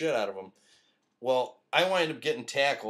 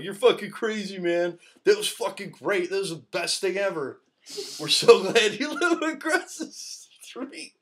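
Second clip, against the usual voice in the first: high, loud hyped-up buddy voice; hyped praise; 8 semitones higher, 6 dB louder than usual. Retold or imitated. Friends praising him after the fight, high and loud hyped-up. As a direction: gush like a wired friend. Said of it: "They're like".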